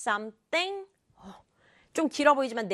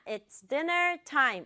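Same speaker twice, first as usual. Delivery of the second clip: ordinary talk, nothing marked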